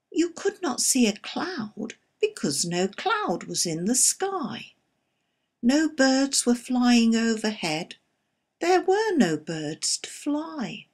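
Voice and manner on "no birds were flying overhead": ordinary delivery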